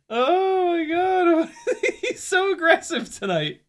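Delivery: high, drawn-out cry into giggles; laughing. Real, not acted. Dog attacking the drone, high laughing. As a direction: squeal it, still cracking up. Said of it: "I'm sure she can hear the laughter".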